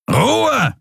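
very high bellowed command; roared order. Acted. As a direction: roar it to shut them up. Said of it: "brüllte man sie an".

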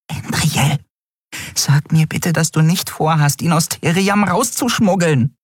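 high, tiny fairy voice, upset; a little winged friend scolding in alarm; agitated fairy. A narrator's reading. Nelen pleading. (aufgeregte kleine Fee, hoch)

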